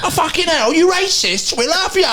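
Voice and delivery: high imitating an excited fan; mock fan voice. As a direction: squeal it like an adoring fan. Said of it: "They would have been like".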